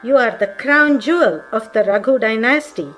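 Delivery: plain reading voice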